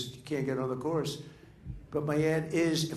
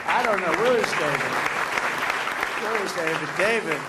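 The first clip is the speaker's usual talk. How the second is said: loud searching call across the room; calling out loudly; 4 semitones higher, 6 dB louder than usual. Real, not acted. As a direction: call out his name to find him in the crowd. Calling out for David in crowd.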